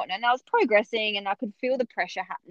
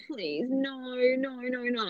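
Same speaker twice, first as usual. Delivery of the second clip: higher, softer begging no; pleading; 4 semitones higher, 4 dB quieter, slower than usual. Retold or imitated. Mother told forceps are coming, soft and pleading. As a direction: plead quietly in dread.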